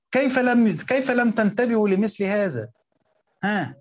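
can't believe they missed it, raise the voice; exasperated rebuke. Real, not acted. Teacher to students who missed the point, exasperated rebuke.

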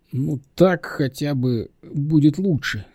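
mildly apologetic self-defence; defensive excuse. Acted. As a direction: make a lame excuse. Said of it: "заоправдывался вдруг он".

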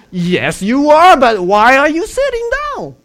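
high, loud frustrated Balaam; exasperated high voice. Retold or imitated. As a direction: argue with the donkey, high and shrill. Balaam arguing with donkey.

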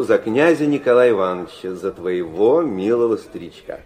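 usual speaking voice

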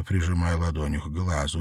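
plain reading voice

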